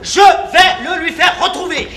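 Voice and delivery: loud, high fairground barker; showman's pitch. Acted. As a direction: sell it to the crowd. Showman addresses the audience.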